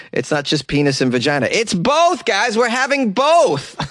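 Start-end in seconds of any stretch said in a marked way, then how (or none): 1.5-3.8 high, elated party reveal; mock-excited announcement. Host plays parent at a gender reveal, mock excitement.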